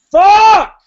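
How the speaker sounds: sudden high, loud shout of frustration; yelling. Retold or imitated. Rider on a brutal climb, yelling out loud. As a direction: scream it out on the climb. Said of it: "just fucking yelling".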